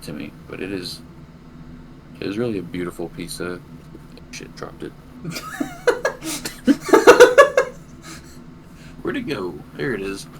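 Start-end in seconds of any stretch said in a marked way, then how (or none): 5.0-9.0 big high laugh at his own clumsiness; loud burst of laughter. Real, not acted. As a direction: crack up loudly after dropping it. Drops gemstone on stream.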